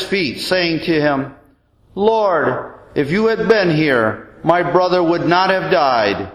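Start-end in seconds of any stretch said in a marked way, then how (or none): none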